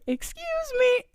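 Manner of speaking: very high-pitched polite interruption; imitating a little girl. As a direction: pipe up in a tiny girl's voice. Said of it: "She's like".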